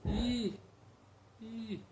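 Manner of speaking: high I repeated; high I vowel; I sound demo. Shown as a demonstration. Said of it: "É mais ou menos isso".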